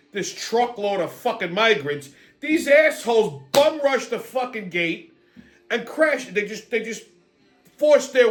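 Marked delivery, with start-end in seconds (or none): none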